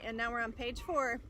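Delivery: ordinary delivery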